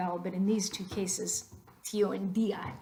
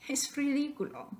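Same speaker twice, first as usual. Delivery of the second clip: usual speaking voice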